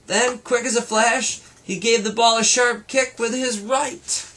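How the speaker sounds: rising storybook excitement, pitch climbing toward the lamp; building excitement. A narrator's reading. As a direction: build the suspense of the kick toward the crash. Ball-kicking action climax.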